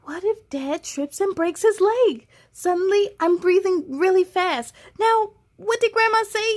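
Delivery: put-on fast anxious child; panicking child. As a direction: rush the words in panic.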